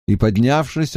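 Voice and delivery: plain reading voice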